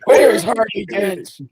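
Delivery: slow, gravelly Batman growl. Pretend. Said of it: "do your Batman thing now".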